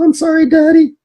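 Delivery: put-on very high, whiny little girl voice; mimicking crying child. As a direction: squeak it out like a sobbing four-year-old. Retold daughter apologizing.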